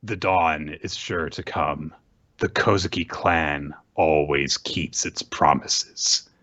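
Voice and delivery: lightly voiced calm vow; solemn princess. Hiyori vowing as Orochi burns, slow and grave. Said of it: "Hiyori just says".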